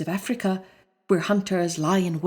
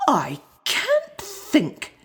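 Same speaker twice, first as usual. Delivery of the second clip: slow, high wondering voice; puzzled lion; 8 semitones higher, much slower than usual. Acted. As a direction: puzzle over it slowly.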